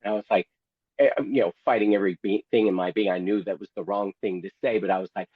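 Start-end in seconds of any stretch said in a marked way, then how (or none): none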